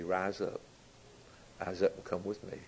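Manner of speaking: soft call. Acted. Preacher voicing Jesus calling the bride, hushed and low.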